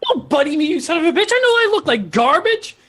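high, indignant outburst; angry Weasel. Acted. Weasel rejecting Edgar's flattery, high and angry. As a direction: throw it back in a high squawk.

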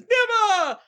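high defiant shout; defiant cry. Retold or imitated. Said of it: "one little guy that says".

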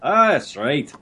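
proud hammy Scot; mock Scottish accent. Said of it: "I'm a Scot".

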